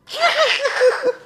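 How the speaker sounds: sudden high-pitched laugh. Acted. Bursts out laughing.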